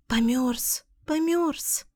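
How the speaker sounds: tiny frightened gosling murmur; little goslings' voices. A narrator's reading. Goslings murmuring that it froze, soft and high.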